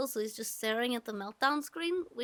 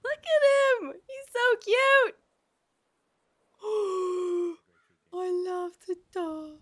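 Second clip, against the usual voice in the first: cooing; 7 semitones higher, 6 dB louder, slower than usual. Real, not acted. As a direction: coo over the cute dog. Cooing at a pet dog.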